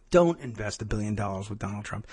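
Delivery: muttered aside. Real, not acted. Aside muttered under the reading.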